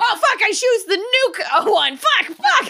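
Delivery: high, drawn-out oops with laughter; hammed-up high-pitched swearing; mock panic. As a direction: realize your blunder in comic panic. Acting Zeus panicking at wrong choice.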